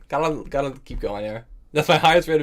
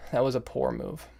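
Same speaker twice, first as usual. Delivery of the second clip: softer, muted verdict; subdued self-criticism; 7 dB quieter than usual. Real, not acted. Chess player repeating the verdict to self, subdued. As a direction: say it softly to yourself.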